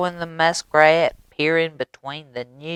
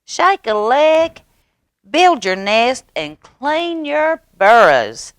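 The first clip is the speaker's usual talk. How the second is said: high loud frog call; frog shout; 10 semitones higher, 7 dB louder than usual. A narrator's reading. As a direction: rouse the whole forest. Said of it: "he shouted".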